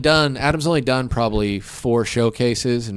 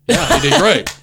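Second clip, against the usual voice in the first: high, louder, laughing through it; laughing quote; 8 semitones higher, 6 dB louder than usual. Retold or imitated. Comic quotes booker praising Eric, laughing high. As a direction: burst out laughing mid quote.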